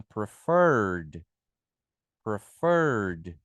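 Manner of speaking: preferred said prefɛd with a clear d ending; model pronunciation. Shown as a demonstration. Teacher models preferred for students, ending in d. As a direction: say preferred slowly, land on the d. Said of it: "a gente tem que pôr o E D aqui".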